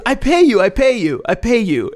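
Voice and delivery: eager masseuse. Retold or imitated. Masseuse offering to pay the tourists, high mock pleading. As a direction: plead to pay them, hammed-up.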